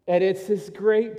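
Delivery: normal talking voice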